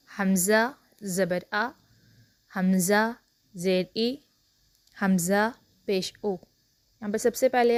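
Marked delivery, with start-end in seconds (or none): 0.1-6.3 "हमज़ा ज़बर आ" read slowly letter by letter, short clean vowels; takhti recitation. Shown as a demonstration. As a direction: drill the takhti aloud like a Qaida teacher. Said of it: "अब चलते हैं हम अपनी तख्ती की जानिब". ("हमज़ा ज़बर आ" धीरे धीरे साफ़ पढ़ना)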